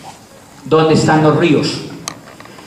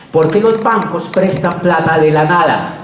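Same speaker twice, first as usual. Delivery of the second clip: ordinary talk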